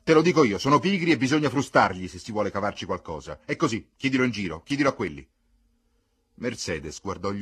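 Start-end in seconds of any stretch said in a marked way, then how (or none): 0.0-5.3 loud, higher, forceful argument; scornful insistence. A narrator's reading. Brother telling sister the dogs need whipping, loud scornful insistence. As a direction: insist over her objections. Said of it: "rispose ghignando il fratello".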